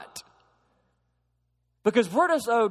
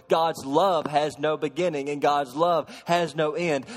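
ordinary delivery

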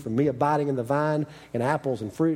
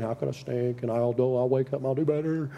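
a touch of sorry-for-himself resolve; mock self-pity. Preacher imitating his old self beating himself up, mock self-pity. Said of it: "I get all frustrated and self-pity".

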